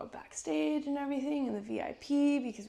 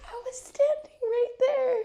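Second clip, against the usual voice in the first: high, slow, laughing near tears; overwhelmed; 8 dB louder, slower than usual. Retold or imitated. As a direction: relive the thrill, choked up. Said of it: "And I was like".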